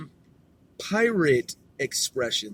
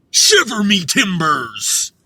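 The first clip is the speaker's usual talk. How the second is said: pirate line; 4 semitones higher, 6 dB louder, slower than usual. Pretend. Reading pirate phrases aloud.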